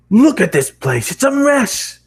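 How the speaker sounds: loud, high, slow bellow; angry boss. Acted. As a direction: roar at the wrecked store. Boss storming out.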